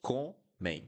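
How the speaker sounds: comem in two drawn syllables, nasal ending; careful model pronunciation. Shown as a demonstration. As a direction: say comem slowly, ending on an open nasal sound. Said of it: "close your mouth in".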